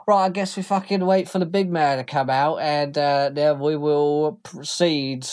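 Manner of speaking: rough cockney thug voice; gruff underling. Acted. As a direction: growl it like a hired heavy. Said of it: "He says".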